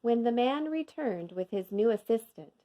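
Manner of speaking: ordinary delivery, nothing marked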